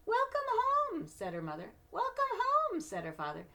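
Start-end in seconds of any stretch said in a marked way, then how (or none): 0.1-1.1 very high, slow sing-song welcome; sing-song mom. Acted. Mother greeting fondly. 1.9-2.8 very high, slow sing-song welcome; sing-song mom. Acted. Father greeting fondly.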